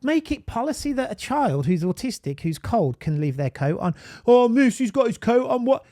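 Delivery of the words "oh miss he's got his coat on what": loud, high-pitched Brummie schoolkid complaint; Brummie accent. Acted. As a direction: whine to the teacher in a Brummie accent. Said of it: "my Brummie accent was coming out".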